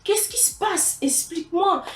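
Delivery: a teacher coaxing a crying pupil to talk; concerned teacher. Retold or imitated. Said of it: "Le prof, il m'a dit".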